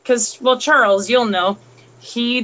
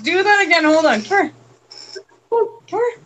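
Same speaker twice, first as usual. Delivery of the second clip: high, sing-song voice for the dog; pet talk; 10 semitones higher than usual. Real, not acted. Talking to the dog Coda.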